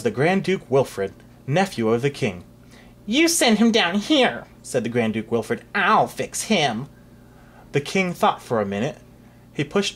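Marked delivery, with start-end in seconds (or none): 3.0-6.9 put-on slow, smug child's voice; proud little boy. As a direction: sneer it like a spoiled brat. Proud little duke speaks.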